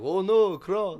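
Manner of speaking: high, loud panicked vampire; a terrified vampire shrieks. Acted. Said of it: "I like your vampire voice".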